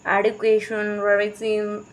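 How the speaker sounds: put-on American teacher accent; mock American accent. Imitating a teacher in India, mock American accent. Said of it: "American accent नहीं चलती, फिर, फिर भी teacher".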